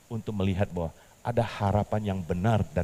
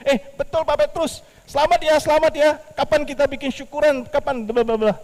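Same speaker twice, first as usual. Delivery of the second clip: much higher, louder, gushing; excited congratulations; 8 dB louder than usual. Acted. A bank friend congratulating Petrus on the car, thrilled. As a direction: cheer him on like a thrilled friend.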